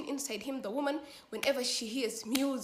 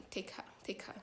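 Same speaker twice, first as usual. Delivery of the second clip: soft, low-energy whispered surrender; resigned near-whisper; 3 semitones lower, 11 dB quieter than usual. Retold or imitated. Mother yielding to the jinn's threat, hushed and resigned. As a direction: give in under your breath.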